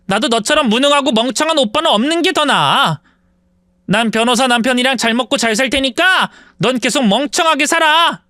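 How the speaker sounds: scornful sister in a heated quarrel; spiteful younger sister. Acted. Sister cutting off her brother, spiteful and heated. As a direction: throw the insult back at him.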